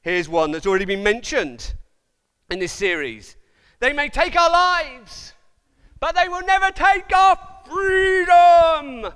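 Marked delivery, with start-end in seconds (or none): 3.8-9.1 slow, loud rallying cry, voice higher; movie battle cry. Retold or imitated. Preacher imitating a film hero's battle cry. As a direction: rouse the troops like a film hero.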